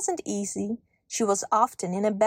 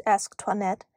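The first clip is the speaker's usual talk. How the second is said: ordinary delivery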